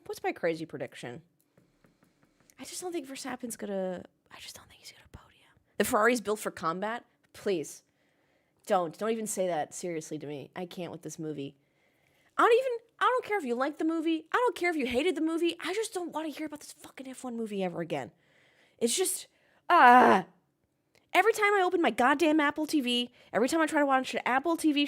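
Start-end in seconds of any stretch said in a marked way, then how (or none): 2.6-5.5 hushed secret prediction; conspiratorial whisper. Acted. Crazy prediction told as secret. 12.4-19.3 raised, fed-up voice; exasperated rant. Real, not acted. Rant about F1 movie ads. 19.7-20.2 loud exasperated "ah"; fed-up yell of a groan; frustrated groan. Real, not acted. Rant frustration outburst.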